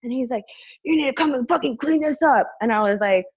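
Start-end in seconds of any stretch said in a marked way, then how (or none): 0.8-2.5 high-pitched imitated angry order; retold irate command; raging man. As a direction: mimic a furious guy demanding it. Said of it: "like, raging".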